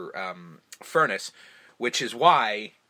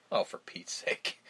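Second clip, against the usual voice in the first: slow, whispered, fed-up aside; imitating a weary whispered grumble; exasperated whisper; 7 dB quieter, slower than usual. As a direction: breathe it out under your breath, slow. Retold exasperated line entering home.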